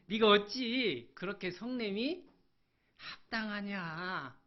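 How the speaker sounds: high and loud, then a slow drawn-out question; God's stern rebuke. Acted. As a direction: rebuke him, stretching the last word. Preacher voices God rebuking Jonah. (크게 꾸짖다가 느리게 늘이는 하나님의 물음)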